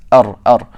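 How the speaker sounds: ar, ar with a quick strike on the gums; tapped Arabic ra. Shown as a demonstration. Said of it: "that striking effect".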